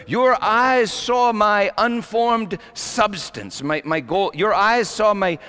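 high, emphatic pulpit delivery; impassioned preaching. Real, not acted. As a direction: preach it out, stretching the big phrases. Recites and explains psalm.

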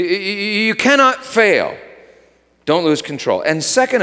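ordinary talk

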